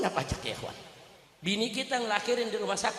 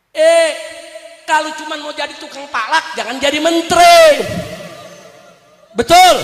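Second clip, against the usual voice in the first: loud, raised scolding oratory; full-force preaching; 6 semitones higher, 13 dB louder than usual. Real, not acted. Preacher scolding a minister who taxes the people, loud preaching. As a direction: berate the minister in front of the crowd.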